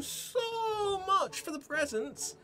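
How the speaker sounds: put-on very high, breathless child gushing over presents; little girl thanking Miss After for name day gifts, very high and excited. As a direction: squeal it like a thrilled kid. Voices a little girl NPC.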